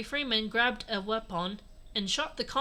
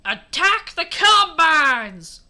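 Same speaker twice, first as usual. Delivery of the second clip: very loud, drawn-out war cry; battle yell; 5 semitones higher, 11 dB louder, much slower than usual. A narrator's reading. Henry ordering the attack, huge drawn-out yell. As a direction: bellow the order, stretching each word. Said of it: "Henry Freeman yelled again".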